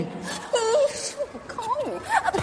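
scornful spit. Acted. Wife scoffs, mock spit.

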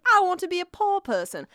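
high, hammed-up British accent; bad British accent. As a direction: imitate an actress's terrible British accent. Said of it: "doing a really bad British accent".

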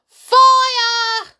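piercingly high, loud alarm cry; old woman's yell. A narrator's reading. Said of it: "the old woman yelled".

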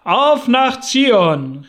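sehr laut, höher und langsam, AUF betont; im Chor gerufen. A narrator's reading. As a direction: ruf „Auf nach Zion!“ wie eine jubelnde Menge. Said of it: "riefen die Mormonen im Chor". (als Chor laut und hoch gerufen)

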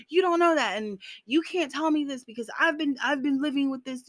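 put-on hurt retort to someone promising she'll have a baby; upset retort. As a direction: push back at the well-wisher, stung. Her retort to the well-wishers.

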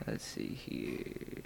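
slow hushed mutter; whispering. Real, not acted. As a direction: whisper slowly to yourself. Muttering to self while searching.

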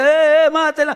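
loud, high crowd yell; bay for her death like an angry mob; shouted mob cry to kill. Acted. Mob shouting to stone woman.

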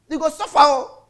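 high laughing taunt; mocking through laughter. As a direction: finish the jeer still laughing. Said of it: "he said".

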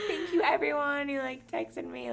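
high, laughing tearful whine; weepy gratitude through a laugh, hammed up; emo mock crying. Drunk birthday girl thanking texters, mock weepy.

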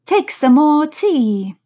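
slow, loud, earnest offer. A narrator's reading. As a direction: press it on her with great earnestness. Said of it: "very earnestly".

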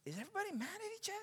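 quick, high, very soft question; retold hushed worry. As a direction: ask discreetly so the family won't hear. Discreetly asks about her family.

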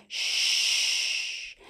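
whispered hush; soft shushing. Pretend. Hushing the baby at bedtime, whispered shh.

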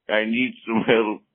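ordinary talk, nothing marked

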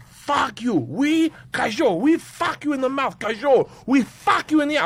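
high-pitched mock Russian rant; ranting gangster. Gangster abusing a cop, ranting. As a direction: imitate a gangster cursing in broken English. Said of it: "And he's like".

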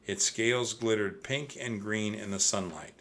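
ordinary delivery

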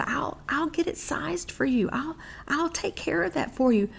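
softly smooth it over for her; gracious mother. Retold or imitated. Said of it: "my mother so graciously said".

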